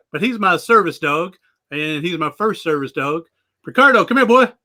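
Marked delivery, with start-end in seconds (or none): 3.6-4.5 high, louder call to the dog across the room. Real, not acted. Owner calling his service dog over, high and loud. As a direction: call him in, bright and carrying.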